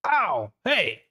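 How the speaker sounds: high, indignant yelp of a struck creature; yelp, then complain, pitched high; hurt monster. Acted. Monster hit by a blind punch, high indignant yelp. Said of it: "And it goes".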